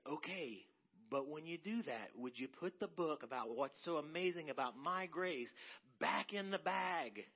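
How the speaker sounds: God gently scolding, dragging out "back in the bag"; God's voice. Acted. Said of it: "And it was like God was saying".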